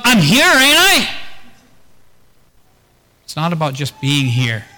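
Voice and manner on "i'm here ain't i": higher, slow, indignant protest; mock offended "ain't I?"; defensive husband. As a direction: play the husband huffily defending himself. Acted husband-wife argument.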